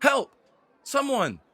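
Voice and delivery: high, urgent call; crying for help. Acted. Elven woman calls passers-by to help the child, high shout. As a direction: shout to anyone passing by. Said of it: "crying for help".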